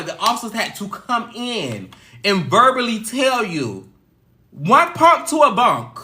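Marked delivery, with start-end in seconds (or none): 4.5-6.0 officer's rule. Retold or imitated. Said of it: "That was the saying".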